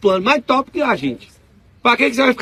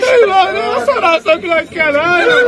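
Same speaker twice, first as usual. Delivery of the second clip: laughing high protest; 11 semitones higher, 6 dB louder than usual. Real, not acted. Resposta rindo, voz alta.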